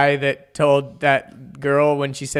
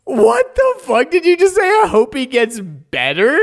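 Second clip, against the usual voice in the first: much higher, stunned disbelief, rising at the end; incredulous girl; 11 semitones higher, 3 dB louder than usual. Retold or imitated. Girl stunned by [name]'s reply.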